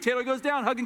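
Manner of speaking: ordinary talk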